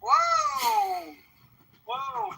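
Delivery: high, drawn-out "whoa"; startled high-pitched exclamation; exclaimed whoa. Real, not acted. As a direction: react with a long high whoa. Mock alarm at chat threat.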